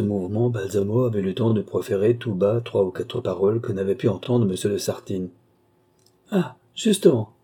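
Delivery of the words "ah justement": high-pitched exclamation; exclaiming. A narrator's reading. Countess spotting what she came for, exclaiming. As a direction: cry out as the countess, pitched well up. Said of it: "s'écria la comtesse".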